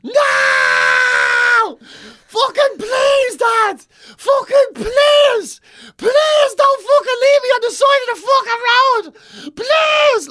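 high, loud, slow panicked begging; terrified pleading. Retold or imitated. Boy begging his dad not to abandon him at the site. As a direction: beg your dad not to leave you. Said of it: "And I'm, like, going".

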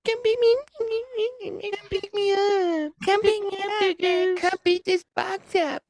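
put-on very high, slow girly whine; high-pitched woman voice. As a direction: squeak it out like a needy girl. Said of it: "She's like".

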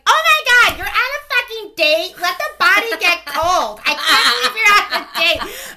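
high, loud shocked yelling; sister screaming. Retold or imitated. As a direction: shriek it at her in disbelief. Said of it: "screaming at each other in the bathroom".

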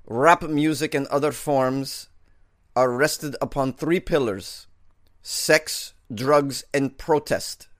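slow mock Russian accent. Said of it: "Putin said".

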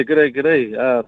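ordinary talk, nothing marked

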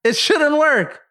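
very high, squealed disbelief; incredulous exclamation. Real, not acted. Co-host marveling that the song sequence works, incredulous squeal. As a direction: let the voice shoot way up in amazement.

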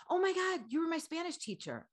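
high, excited gush; excited fan. Retold or imitated. Fan recognizes her excitedly.

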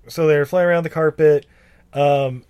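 ordinary delivery

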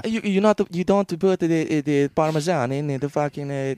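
high mock Italian chef voice; Italian accent. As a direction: scold about carbonara like an Italian. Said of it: "Italian accent".

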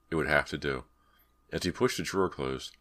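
plain reading voice

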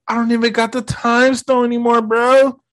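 imitated protest, pitched high throughout; high, exasperated imitation. Strange protests to Peter.

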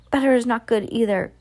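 a contained mock shriek. A narrator's reading. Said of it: "shrieked the Grand High Witch".

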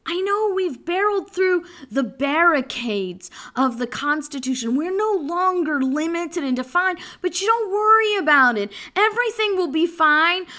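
building from assurance to loud pleading; mock politician. Mimics government pleading for more.

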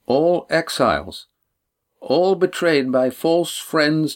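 slow, solemn plea for sanctuary; measured, deliberate young woman; earnest Lindy. A narrator's reading.

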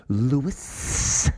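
imitated needling call with rising end; grating taunt. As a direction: draw the name out like nails on a chalkboard. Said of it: "That is nails on a chalkboard".

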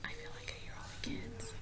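hushed whisper. Real, not acted. Listening for kids nearby.

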